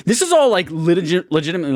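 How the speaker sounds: incredulous excited outburst; amazed. Real, not acted. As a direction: burst out in astonishment. Amazed the actor voices every clone.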